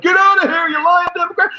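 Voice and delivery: high, loud mock mob shout; hammed-up angry rally mob; angry crowd yell. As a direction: chase him off with a yell. Crowd chasing a man.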